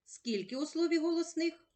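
ordinary talk

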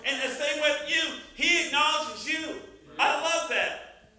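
loud, high, impassioned testimony; full-force preaching. Acted. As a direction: proclaim it to the whole congregation.

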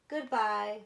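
ordinary delivery, nothing marked

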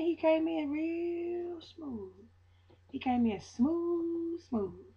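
soft, slow, hushed delivery; smooth and gentle. Real, not acted. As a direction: ease it out slowly like silk. Describing God's gentle arrival.

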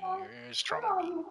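high, soft tender praise; tender encouragement. Real, not acted. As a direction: coo it gently to encourage him. Dad encouraging his son.

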